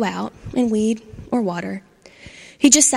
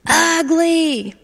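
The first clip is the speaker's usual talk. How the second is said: loud, slow, higher yell; 7 semitones higher, 5 dB louder than usual. Acted. Angry Charlie yelling at a gardenia, loud and slow. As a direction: bellow the insult slowly. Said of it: "he yelled at them".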